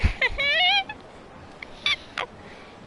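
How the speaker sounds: very high shrieking cackle; screaming laugh. Retold or imitated.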